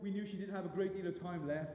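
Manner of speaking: ordinary talk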